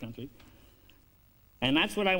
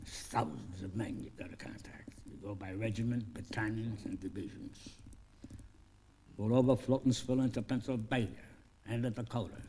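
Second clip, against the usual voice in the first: hushed whisper; whispered orders; 4 semitones lower, 7 dB quieter than usual. Retold or imitated. Lincoln briefing Biff in secret, whispering. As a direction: pass on secret instructions under the breath.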